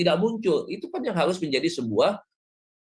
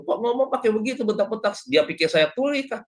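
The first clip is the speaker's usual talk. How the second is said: mock affronted protest, pitched up; mildly offended; 7 semitones higher than usual. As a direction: lightly act out a listener taken aback. Acts out offended Javanese listener's reaction.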